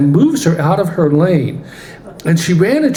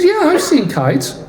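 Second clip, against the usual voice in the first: put-on childlike reply; 9 semitones higher than usual. Young angel voiced replying.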